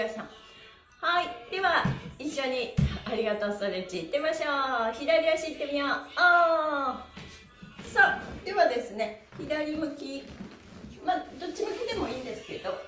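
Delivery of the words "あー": ordinary delivery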